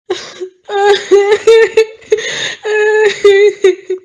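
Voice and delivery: high, loud wailing sob; loud sobbing. Acted. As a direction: break down sobbing. Daughter breaks down at news.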